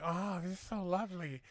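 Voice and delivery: slow, lightly put-on impeded speech; a hint of the character's speech impediment. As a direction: joke teller voicing the little man at the door. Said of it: "he's got a fucking speech impediment".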